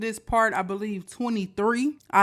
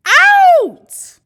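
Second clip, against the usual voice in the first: slow, loud, stretched-out sign-off; drawn-out loud outro shout; 17 dB louder than usual. Real, not acted. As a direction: shout the name out long like a host. Signing off the episode.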